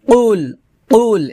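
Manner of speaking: "কুল" as qul from the tongue root; deep back q. Shown as a demonstration. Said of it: "জিহ্বার গোড়া উপরে তালুর সঙ্গে লাগিয়ে".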